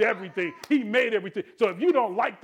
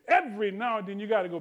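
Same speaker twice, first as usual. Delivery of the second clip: normal talking voice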